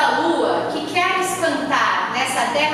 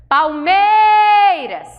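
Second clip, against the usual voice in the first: higher, louder, very slow yell of Palmeiras; shouted from the hill; 6 semitones higher, 6 dB louder, much slower than usual. Shown as a demonstration. Teacher showing the stressed syllable, long yell. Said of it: "Subiu lá e grita".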